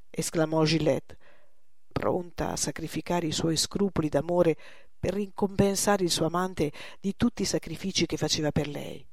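usual speaking voice

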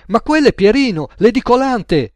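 high, loud cry of recognition; excited shout. A narrator's reading. Cashier cuts Gaetano off on spotting Pierino, shouting. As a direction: yell it out, cutting right in. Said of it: "urlò Lola".

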